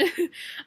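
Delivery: quick light laugh; small embarrassed laugh; short laugh. Real, not acted. Laughing after the retold apology.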